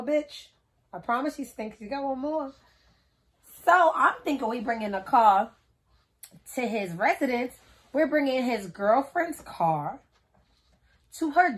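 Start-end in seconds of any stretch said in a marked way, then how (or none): none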